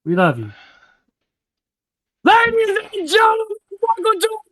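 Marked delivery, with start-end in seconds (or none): 2.2-4.4 much higher announcer call; ring announcer. Acted.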